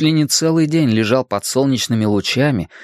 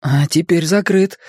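higher-pitched uneasy reply; nervous; 6 semitones higher than usual. A narrator's reading. Richie answers Bill about the closed album, nervous. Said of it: "нервно ответил Ричи".

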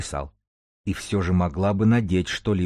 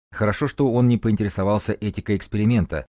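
usual speaking voice

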